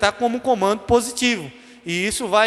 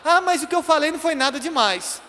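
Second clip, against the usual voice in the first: higher-pitched dismissive protest; defensive excuse; 6 semitones higher than usual. Acted. Someone excusing what they said to a hurt person, defensive. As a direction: brush it off as nothing.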